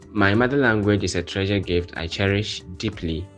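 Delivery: ordinary talk, nothing marked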